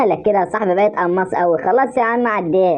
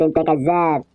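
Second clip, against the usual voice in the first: ordinary talk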